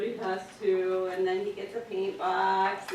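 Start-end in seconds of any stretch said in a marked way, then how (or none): none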